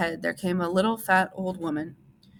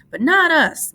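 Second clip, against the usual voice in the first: much higher and louder, happy; Lenny happy, pitched up high and loud; happy cry; 11 semitones higher, 10 dB louder, slower than usual. A narrator's reading. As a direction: shout it with joy. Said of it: "Lenny cried happily".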